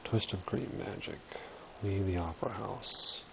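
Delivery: usual speaking voice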